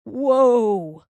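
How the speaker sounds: drawn-out stopping call; shouted command. A narrator's reading. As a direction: holler the calves to a stop. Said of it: "They stopped when he shouted".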